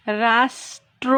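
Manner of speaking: slow demo splitting রাষ্ট্র into its syllables; syllables split. Shown as a demonstration. Teacher breaking রাষ্ট্র apart slowly, no doubling. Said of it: "উচ্চারণটাকে এখানে ভেঙে নিয়েছি".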